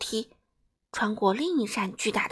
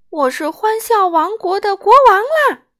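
much louder, higher triumphant giggle; gleeful king; 10 semitones higher, 12 dB louder than usual. A narrator's reading. King announces his new kingdom, gleeful. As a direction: proclaim it with delighted laughter. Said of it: "他咯咯笑着说".

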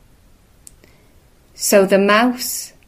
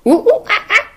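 monkey hoots; 13 semitones higher, much faster than usual. Acted. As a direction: hoot like a monkey. Monkey answers the mouse.

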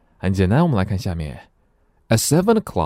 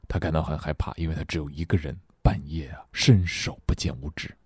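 low, soft, scared undertone; hushed as if alone in the dark; fearful hush; 3 semitones lower, 7 dB quieter, faster than usual. Shown as a demonstration. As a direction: lower the voice to a frightened murmur. Explains scared alone at midnight.

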